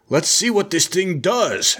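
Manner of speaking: high, slow mock Russian accent; horrible Russian accent. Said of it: "my horrible Russian accent".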